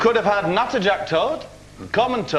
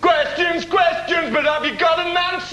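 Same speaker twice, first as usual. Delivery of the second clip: mock bellow; 10 semitones higher than usual. Gameshow host bellows catchphrase.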